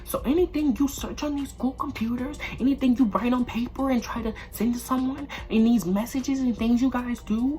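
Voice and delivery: high, preachy teacher voice; imitating the teacher. Teacher warns the class that they will find out. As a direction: mimic her lecturing the class. Voicing stern teacher warning.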